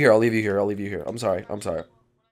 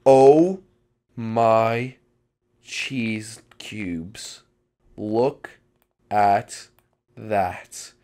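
slow, word-by-word hushed amazement; drawn-out awe; stunned awe; 4 semitones lower, much slower than usual. Acted. Awed reaction to a sight.

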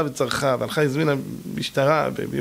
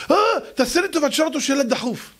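mother's voice; 10 semitones higher, faster than usual. Retold or imitated. Mother voiced in retold story.

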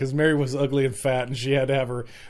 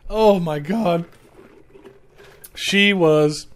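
ordinary talk

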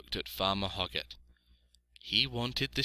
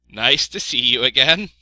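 loud, raised sheep voice; friendly sheep; 5 semitones higher, 14 dB louder than usual. A narrator's reading. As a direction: bleat out a welcome.

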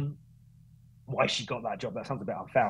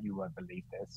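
soft, higher-pitched imitation; recalled colleague's voice; 6 semitones higher, 11 dB quieter than usual. Race director phoning with unbelievable news, soft higher-pitched imitation. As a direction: echo her voice quietly, pitched up. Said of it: "I can hear her voice now".

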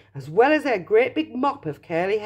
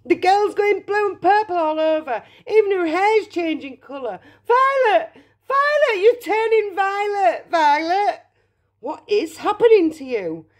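high, frantic mother's cries; panicked yelling; 13 semitones higher, 4 dB louder than usual. A narrator's reading. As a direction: wail in escalating horror at your child. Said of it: "yelled Missus Beauregard".

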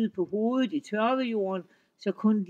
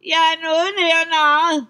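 troll yelling; 9 semitones higher, 11 dB louder than usual. Acted. Troll fooled again, louder.

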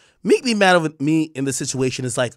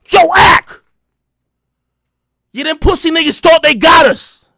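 loud, high-pitched mock hollering; hyped boasting caller; 11 semitones higher, 10 dB louder than usual. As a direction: imitate a worked-up crew member bragging. Acting [name]'s team boasting by phone.

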